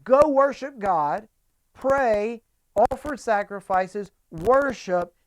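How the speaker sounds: loud, high, slow preaching; a fervent rising plea to worship; fervent exhortation. Real, not acted. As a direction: raise your voice to urge them. Preacher urging the congregation.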